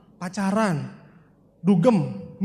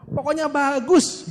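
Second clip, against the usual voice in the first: higher, slow, sing-song dodge; coy evasive dodge; 7 semitones higher, 3 dB louder, much slower than usual. Retold or imitated. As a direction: dodge the question with a higher, drawn-out voice. Evasive friend dodging MLM question. (menghindar dengan nada tinggi, lambat, manja)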